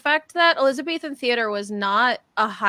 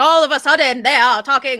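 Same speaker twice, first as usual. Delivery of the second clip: louder, declaimed stage voice; Shakespeare voice; 4 semitones higher, 7 dB louder than usual. Shown as a demonstration. Teacher mocking actors onstage, exaggerated Shakespeare voice. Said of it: "we call it Shakespeare voice".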